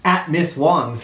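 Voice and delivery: ordinary delivery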